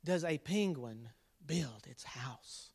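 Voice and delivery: slow whispered riddle. Acted. Dad whispering the riddle to his viewers. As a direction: breathe the question secretly.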